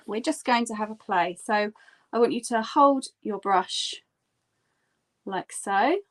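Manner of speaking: ordinary delivery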